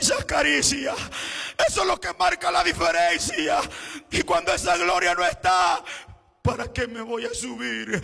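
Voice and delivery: high, loud sung-out preaching cry; full-force preaching. Acted. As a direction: preach it to the back row, chanting each phrase.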